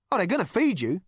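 loud, high sarcastic echo; mocking agreement. A narrator's reading. Rooster answers Zaphod's hope of being fed, mocking. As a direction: throw his hope back at him.

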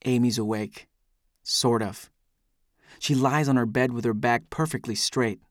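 ordinary delivery, nothing marked

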